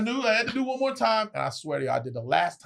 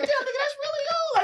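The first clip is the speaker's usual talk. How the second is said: much higher, mocking; mocking an ugly guy with a model girlfriend; sarcastic disbelief.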